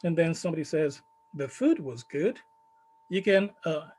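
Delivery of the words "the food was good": "The food was good" with a fall-rise on "food", implying the rest was not. Shown as a demonstration. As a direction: let "food" dip then lift to hint at a but. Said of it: "the tone being used here is a fall rise".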